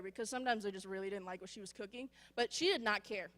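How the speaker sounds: usual speaking voice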